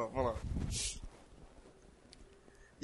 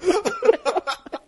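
high shrieking laugh fit; hysterical laugh; 12 semitones higher, 8 dB louder than usual. Real, not acted. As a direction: lose it completely, shrieking. Uncontrollable laughter.

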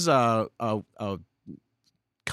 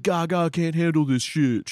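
quoting a rapper; 7 semitones higher, 5 dB louder than usual. Retold or imitated.

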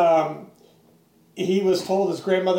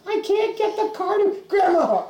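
much higher, quavery granny; mock old lady voice; 10 semitones higher than usual. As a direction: play the helpless grandmother. Grandma voiced.

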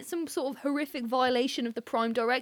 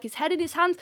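ordinary delivery

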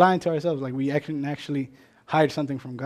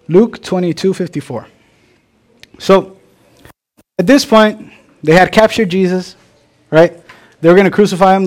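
much louder, ringing sermon voice; full-force preaching; 12 dB louder than usual. Acted. Pastor to congregation, loud fervent preaching. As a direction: preach it out to the back row.

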